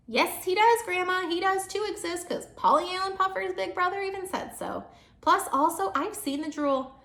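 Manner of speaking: put-on high, loud protesting child; little girl loudly insisting to grandma; loud insistent kid. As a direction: argue it loud and stubborn. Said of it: "calm down with my voice".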